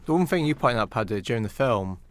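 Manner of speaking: usual speaking voice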